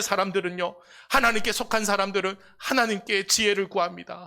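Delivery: high, loud fervent sermon; preaching at full force. Real, not acted. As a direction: drive the message home to the congregation. (회중에게 높고 크게 외치는 열정적인 설교)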